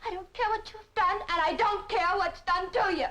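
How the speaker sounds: angry refusal. Acted. Woman refusing her lover's explanation, angry and high. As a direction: cut him off and refuse to hear it. Cuts him off in quarrel.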